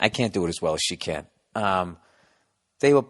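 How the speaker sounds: ordinary talk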